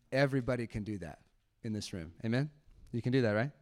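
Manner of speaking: normal talking voice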